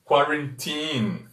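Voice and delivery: put-on quarantine said slowly, kwa-ren-TEEN; careful slow quarantine with English vowels. Shown as a demonstration. Teacher modelling quarantine slowly for the live audience. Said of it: "Nós vamos pronunciar quarantine".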